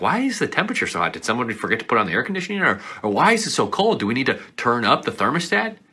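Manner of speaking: playful annoyed grumble; mock complaint. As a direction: whine about the temperature. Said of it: "you're like".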